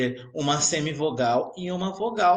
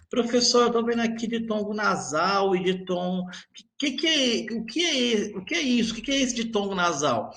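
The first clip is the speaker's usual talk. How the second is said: higher, puzzled student voice stumbling over que; confused student; 6 semitones higher than usual. Acted. Teacher acting a confused student asking about nasal diphthongs, higher and puzzled. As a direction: play a pupil tripping over the question.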